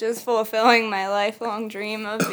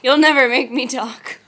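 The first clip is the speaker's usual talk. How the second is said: louder, hammed-up never-crack prisoner line; mock defiance; 7 dB louder than usual. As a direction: play the defiant captive for laughs. Mock interrogation, defiant refusal.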